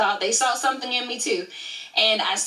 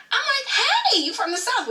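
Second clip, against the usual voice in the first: high, delighted reenacted hello; light up at finding a fellow Southerner; squealed friendly recognition, acted out; excited retold greeting; faster than usual.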